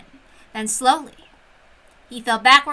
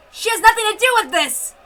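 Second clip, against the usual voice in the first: loud, much higher desperate plea; shouted plea; 9 semitones higher, 5 dB louder than usual. Acted. Man pleading with the officer to spare her, loud shouted plea. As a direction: beg at the top of the voice.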